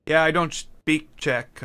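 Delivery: ordinary talk, nothing marked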